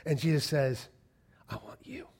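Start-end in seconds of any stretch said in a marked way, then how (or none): 1.5-2.1 soft, intimate whisper; whispered call. Retold or imitated. Jesus calling the hopeless sinner, whispered. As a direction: whisper it straight to one person.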